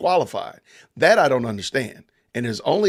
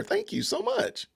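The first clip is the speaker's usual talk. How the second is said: high, slow sing-song thanks; playful falsetto gratitude; falsetto thank you; 7 dB quieter than usual. Real, not acted. Thanking chat viewers, sing-song.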